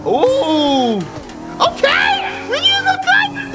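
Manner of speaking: excited yelp. Real, not acted. Streamer squealing at a slick move in the game, excited. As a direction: hype up the clever move with a high squeal. Watching someone drive in the game.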